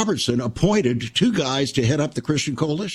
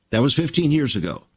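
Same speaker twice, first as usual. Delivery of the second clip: usual speaking voice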